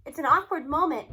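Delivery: ordinary delivery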